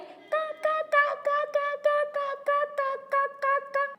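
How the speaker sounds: high, rapid little caws; baby crow cawing. Acted. Newly hatched baby crows calling out, high small caws. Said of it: "the little baby crows went".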